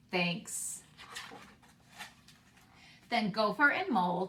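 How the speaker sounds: put-on expressive read-aloud for young children; story narration. Teacher reading a picture book to her class, expressive read-aloud. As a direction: read the picture book warmly and slowly, bringing each animal to life.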